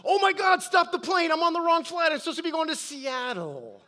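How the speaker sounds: high, loud panicked cry to stop the plane; panicked shout. Retold or imitated. Woman on the wrong flight yells to stop the plane, panicked. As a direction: blurt it out in sudden alarm, fading into a laugh at the end. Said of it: "jumps up out of her chair and she goes".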